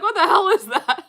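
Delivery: high, loud and laughing, voicing a baffled guest; a friend baffled by the junk, loud laughing question; laughing mock question. Imitating a puzzled visitor.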